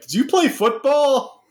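high-pitched, eager question; mock-curious stranger's high voice; imagined curious passerby. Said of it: "People walk up to you like".

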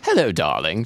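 posh British greeting, chirped; British accent. Acted. Mother greeting her daughter, posh British accent. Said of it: "I should be doing British accents".

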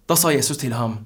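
ordinary delivery

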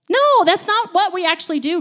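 high, loud, playful protest; emphatic objection. Real, not acted. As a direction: burst in to overturn the giving up. Rejects the pretend giving up.

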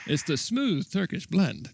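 slow, high vintage pitchman; chipper 1950s ad. Pretend. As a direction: sell it like a retro radio ad. Mock old cigarette ad.

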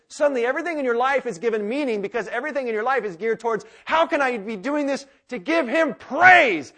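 loud, ringing sermon climb; full-force preaching. Acted. Pastor rousing the congregation, loud preaching. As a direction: preach it out to the whole room.

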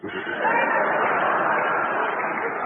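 laughing. Real, not acted. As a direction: crack up at the pun. Laughs at Chan's joke.

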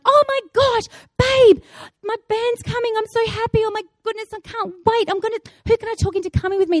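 high-pitched, rushing fan squeal of words; acting out giddy, overexcited fan; gushing excitement. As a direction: gush breathlessly about the favourite band. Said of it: "I am so excited".

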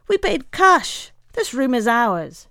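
indignant treasurer claiming the room. Acted. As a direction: insist loudly it's ours. Treasurer insists.

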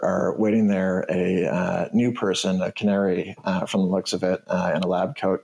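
usual speaking voice

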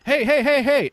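high calling hey. Retold or imitated. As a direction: call out to get attention. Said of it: "they'll just be like".

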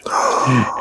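sharp mock-horror gasp; dramatic gasp. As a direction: gasp at a monstrous transformation. Acts out the transformation into lizard.

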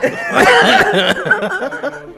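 slow, laughing through a burning nose. Real, not acted. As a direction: talk while your nose stings. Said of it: "my nose burning".

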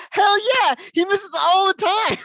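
very high, loud, slowed mock sister shout; shrill sister. Sister answering the doctor about her brother, shrill gleeful mock shout.

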